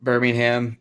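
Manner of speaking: "Birmingham" ending in a clear "ham"; H pronounced. Shown as a demonstration. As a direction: sound the H in "Birmingham". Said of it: "How did you say it, [name]?".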